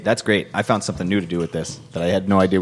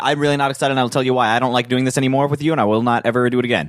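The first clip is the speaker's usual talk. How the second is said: rapid, rushed words; really fast; 5 semitones higher, faster than usual. Shown as a demonstration. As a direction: rattle it off quickly. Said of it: "Bill, I'm talking really fast".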